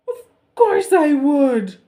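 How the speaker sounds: hammed-up crying king. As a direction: wail it in despair. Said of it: "cried the king".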